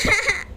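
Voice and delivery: acted sobbing wail; hammed-up weeping; crying. Mother weeps over her endangered baby, acted sobs. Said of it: "रोने लगती है".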